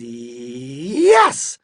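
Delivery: "Yes" with a long held start, bursting out loud; "Yes" swelling to a shout; stubborn insistence. A narrator's reading. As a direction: stretch the start of "Yes", then explode. Man argues back again.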